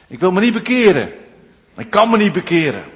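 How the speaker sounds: preacher mimicking a defiant hearer; defiant listener. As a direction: play the stubborn sinner pushing back. Voicing an unwilling churchgoer.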